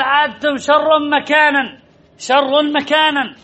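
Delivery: slow recitation. Shown as a demonstration. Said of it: "teacher recites the Quranic example".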